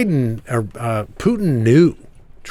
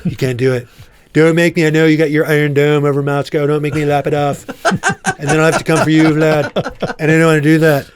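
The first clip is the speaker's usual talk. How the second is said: Trump impression warning Putin; 4 dB louder than usual. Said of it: "I told him, I said".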